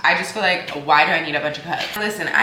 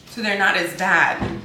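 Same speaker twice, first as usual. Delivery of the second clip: usual speaking voice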